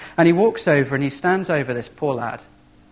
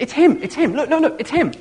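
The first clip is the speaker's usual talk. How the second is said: excited crowd murmur; 11 semitones higher, 4 dB louder than usual. Retold or imitated. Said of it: "lots of people say".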